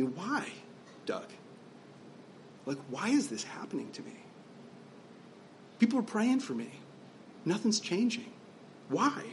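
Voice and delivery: hushed, halting questions with long pauses; quiet, mildly anguished retelling; retold tearful plea. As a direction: softly imitate someone pleading through tears. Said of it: "with tears in their eyes".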